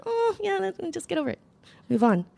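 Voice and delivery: high, airy, offhand get over it; breezy high mom brushoff. Retold or imitated. Mom brushing off her crying daughter, breezy and high. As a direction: lightly toss off her tears like mom would. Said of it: "she's like".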